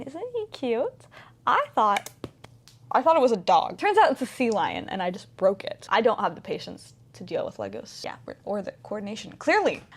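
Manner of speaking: ordinary talk, nothing marked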